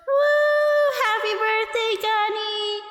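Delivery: high whoop, then a high-pitched birthday wish; loud high woo into a happy shout-out; celebratory cheer. Real, not acted. Cheers after the birthday song.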